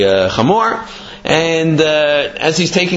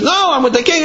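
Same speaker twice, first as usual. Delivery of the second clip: high-pitched acted protest; indignant protest; 12 semitones higher than usual. Fox argues back at the tax-collecting donkey, indignant. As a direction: push back in a raised pitch, playing the fox.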